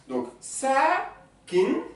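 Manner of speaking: sakin stretched very slowly with a rising end; slow model word. Shown as a demonstration. Teacher presenting new word, slow drawn-out sakin. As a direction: draw out sakin for the class. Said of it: "c'est habitant".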